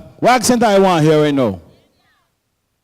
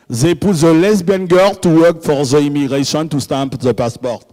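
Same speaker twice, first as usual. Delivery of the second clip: put-on French accent. French traveller complaining to the crowd about immigration, French accent. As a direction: play a Frenchman with a thick accent. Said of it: "I love French accent".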